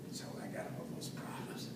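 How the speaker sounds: very soft, voiceless lament; whispered aside. Real, not acted. Speaker sighs over his troubles to the audience, whispering. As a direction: breathe it out quietly.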